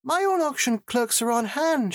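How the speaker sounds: very high, put-on cooing voice. A narrator's reading. Lorelei announcing auction payment arrangements, cooing. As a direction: coo it in a very high register. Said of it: "she cooed".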